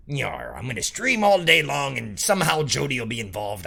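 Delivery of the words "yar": pirate voice. Pretend. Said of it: "Talk Like a Pirate Day".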